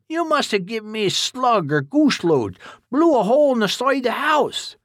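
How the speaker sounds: flustered backwoods grumble; agitated old codger. Acted. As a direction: blame the wife for the wrong shells. Agitated Duke blames Jean.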